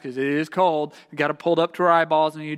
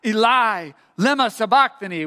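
measured, louder cry in reading; understated calling out; 4 semitones higher, 6 dB louder than usual. A narrator's reading. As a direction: read it as a raised-voice cry. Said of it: "Jesus called out with a loud voice".

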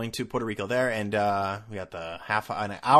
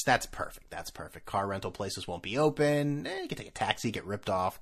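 ordinary delivery

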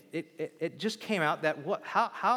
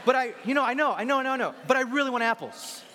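higher, louder whiny begging, a bit fast; whiny pleading; 7 semitones higher, 4 dB louder, faster than usual. Pretend. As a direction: beg like a spoilt child who wants apples. Voicing a whiny branch wanting apples.

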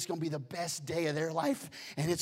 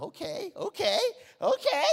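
rising, louder eager okays; 13 semitones higher, 7 dB louder than usual. Retold or imitated. Wife urging husband out the door, eager and rising. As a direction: hurry him up, getting louder each time.

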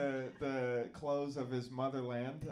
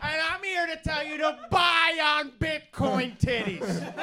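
loud, slow, hammed-up sales guru; finance pitchman; 11 semitones higher, 8 dB louder than usual. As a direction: boom it out like a TV money guru.

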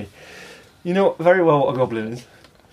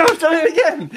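laughing; 13 semitones higher, 4 dB louder than usual. Real, not acted. Laughing at own slip.

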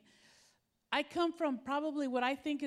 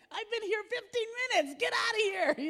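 high, loud fed-up shout; impatient outburst; 10 semitones higher, 6 dB louder than usual. Retold or imitated. Stuck passenger fuming at traffic, high loud. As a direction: vent after fifteen minutes at the light.

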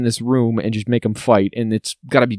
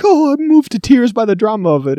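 much higher, hammed-up emotion; mock teary viewer; 11 semitones higher, 5 dB louder than usual. Mocking the Capitol audience's fake tears, much higher. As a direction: fake being moved to tears.

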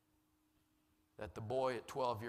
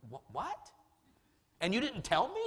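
stammering, high-pitched incredulity; acted flabbergasted outburst, jumping high; mock disbelief; 12 semitones higher, 4 dB louder than usual. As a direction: squeal it as an indignant inner protest. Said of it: "inside of me, I'm going".

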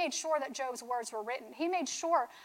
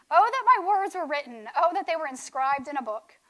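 raised, louder scripture declamation; impassioned proclamation; 7 dB louder than usual. Acted. Preacher proclaims Job's words from scripture.

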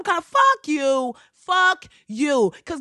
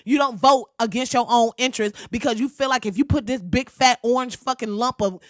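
normal talking voice

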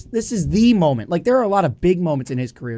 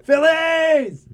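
excited cheer; 10 semitones higher, 3 dB louder than usual. Real, not acted. Celebrating team win.

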